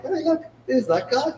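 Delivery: high, slow, eager call; excited spotting. Retold or imitated. Pointing out the banned man to friends, excited. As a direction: point him out with glee.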